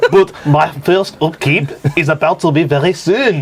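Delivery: put-on French accent. Card forfeit makes player talk this way, mock French accent. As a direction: play it as a comic Frenchman speaking English.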